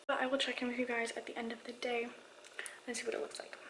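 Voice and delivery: usual speaking voice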